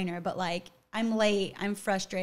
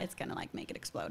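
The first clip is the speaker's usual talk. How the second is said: hushed aside; 14 semitones lower, 10 dB quieter, faster than usual. Real, not acted.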